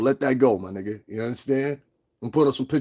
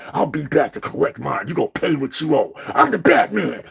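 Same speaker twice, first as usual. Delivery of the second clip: Batman voice; 3 semitones higher than usual. Pretend. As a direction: growl it like a comic-book hero.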